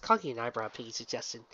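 plain reading voice

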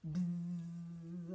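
long, steady buzzing; drawn-out imitated bee hum. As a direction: buzz like a bee. Imitates bee before the reveal.

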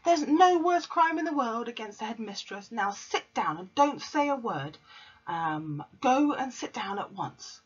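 lightly voiced bossy headmistress; commanding Trunchbull. A narrator's reading. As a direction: order her to sit, no arguing. Trunchbull orders her down.

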